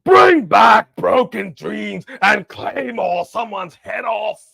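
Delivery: slow, loud mock Scottish accent. Shown as a demonstration. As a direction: bellow it in a hammy Scottish accent. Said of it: "You were supposed to do that in a Scottish accent".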